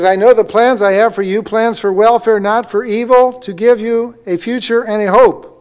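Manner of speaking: ringing pulpit recitation; emphatic scripture reading. Acted. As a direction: recite the verse to the congregation with conviction. Re-reads verse with emphasis.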